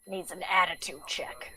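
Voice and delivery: breathy unvoiced threat; whisper. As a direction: hush it like a threat. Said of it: "he's like".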